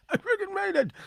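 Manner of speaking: high, delighted cry of disbelief; excited. Retold or imitated. Celebrating a win, high and giddy. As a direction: crow it like you can't believe it worked.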